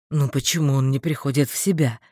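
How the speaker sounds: mild low lament; understated despairing groan; moaning. A narrator's reading. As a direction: moan it in a deep male voice. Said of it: "простонал Дарвальд".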